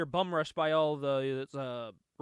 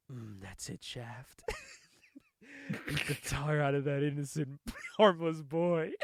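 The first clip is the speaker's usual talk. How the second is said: pervy villain voice; 3 semitones lower than usual. Pretend. Retelling a comic villain's lines, low leering pervert voice. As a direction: purr it like a creepy voice in his head. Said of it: "okay, I'm gonna say it, like, how I think he's saying it".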